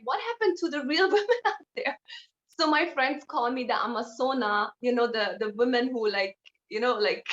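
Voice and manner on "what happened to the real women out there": higher, laughing mock outrage; amused, laughing exclamation; laughing disbelief. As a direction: laugh through the question. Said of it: "And I was like".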